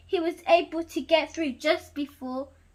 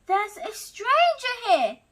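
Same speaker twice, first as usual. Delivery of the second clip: growled; 6 semitones higher, 3 dB louder than usual. Acted. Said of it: "he growled".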